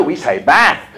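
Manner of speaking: BATH with a short, flat "a" as in cat; short vowel "bath". Retold or imitated. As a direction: say bath firmly with short "a", slowly. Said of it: "the teacher might say".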